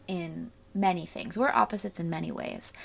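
ordinary talk

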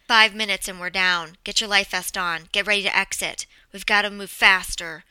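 loud, urgent orders. Acted. Co-pilot ordering a passenger before a crash, urgent. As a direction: call out emergency instructions forcefully.

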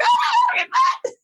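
shrieked high and loud, laughing through it; screaming in panic. Retold or imitated. Singer recalls losing flight case keys, shrieking panic. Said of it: "Like, screaming".

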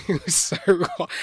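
real laugh while speaking. Real, not acted. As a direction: laugh through the words, drawn out. Laughing while answering about goodbye.

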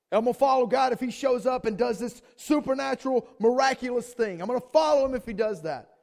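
high, loud, relentless preaching rant; full-force impassioned mimicry; mounting demanding bargaining. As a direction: build into a shouted list of conditions. Voices bargainers, building to full force.

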